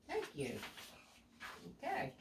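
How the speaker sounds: usual speaking voice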